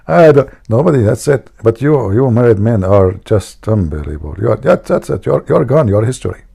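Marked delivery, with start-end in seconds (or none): none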